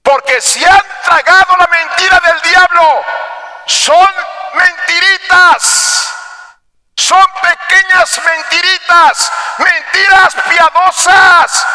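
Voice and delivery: high, forceful preaching shout; prédica a voz en cuello. Real, not acted. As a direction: preach it at full force, pitched high and sustained. Predica a toda fuerza. (predicar a gritos con fervor)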